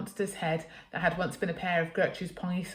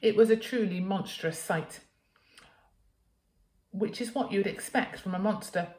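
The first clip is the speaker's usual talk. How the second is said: plain reading voice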